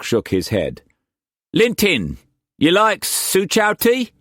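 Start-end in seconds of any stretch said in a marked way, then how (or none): 1.5-4.1 high, loud Cockney pirate-trader voice; Cockney swagger, pitched well up and pushed; harsh Cockney accent. A narrator's reading. Wu Kwok pressing food on Struan, in harsh Cockney accent. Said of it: "the harsh Cockney accent".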